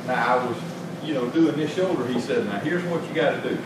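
ordinary delivery

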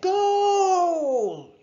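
high, loud triumphant roar. A narrator's reading. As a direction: shout the goal with full joy. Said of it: "he roared".

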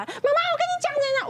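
put-on high, eager child's voice calling mom; a little boy running up to tell mom something; small child calling. Mother imitating her young son calling out to her, high and eager.

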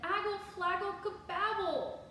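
put-on high, urgent toddler gibberish; toddler babble. A narrator's reading. Toddler trying to tell her daddy, high urgent babble. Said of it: "Trixie turned to her daddy and said".

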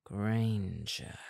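low growled name; grumbled. A narrator's reading. Malfoy warning Hermine, low grumble. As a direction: mutter her name darkly. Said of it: "murrte er".

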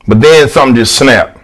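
ordinary delivery

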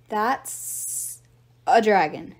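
halting, stunned stammer. A narrator's reading. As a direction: stretch the first word, pause, then land hard on the rest. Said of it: "she stammered".

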